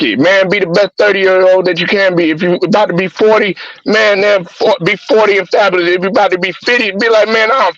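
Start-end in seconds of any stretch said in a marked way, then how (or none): none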